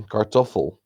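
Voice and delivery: German stress on the middle of "Kartoffel"; German pronunciation. Shown as a demonstration. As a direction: say "Kartoffel" as kar-TOF-fel. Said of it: "German word for potato is".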